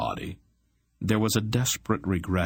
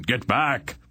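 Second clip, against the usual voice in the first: higher-pitched warning cry; 6 semitones higher than usual. A narrator's reading. Sorcerer warns the attacker off, crying out. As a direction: call out a sharp order, pitched up. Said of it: "Zadar cried again".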